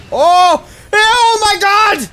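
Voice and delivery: high, loud, drawn-out yell; excited shouting. Real, not acted. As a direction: shout it in total disbelief. Boss battle turns wild.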